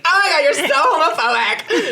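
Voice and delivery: put-on high, loud teen indignation, laughing; hammed-up outraged teenager; high laughing mock accusation at mom; mock outrage. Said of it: "And I was like".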